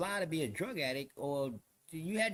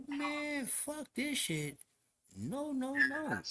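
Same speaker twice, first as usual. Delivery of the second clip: high, softer, drawn-out protest; retold refusal; 9 semitones higher, 4 dB quieter, much slower than usual. Inmate refusing to deal with a suspect newcomer, high protest. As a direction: pitch it up and stretch the refusal.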